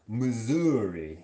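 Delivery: put-on drawn-out Missouri-accented place name. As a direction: stretch it out in a mock Missouri accent. Teasing imitation of Missouri accent.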